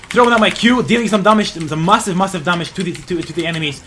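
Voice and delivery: loud, high action commentary; excited play-by-play. Real, not acted. Streamer throwing out his attack, excited play-by-play. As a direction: burst out loud, easing as it goes. Calling a big attack live.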